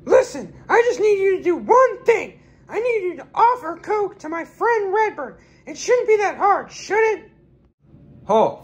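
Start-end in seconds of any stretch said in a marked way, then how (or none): 0.0-7.3 very high, shouted knife threat; bully them in a shrill cartoon pitch; a knife-wielding stranger in a very high voice; threatening stranger. Villain demands a favor.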